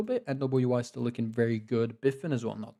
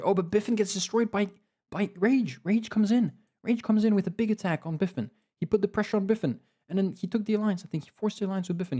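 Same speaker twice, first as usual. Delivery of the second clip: excited play-by-play; 9 semitones higher than usual. Real, not acted. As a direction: call the attack as it happens. Live reaction to big attack.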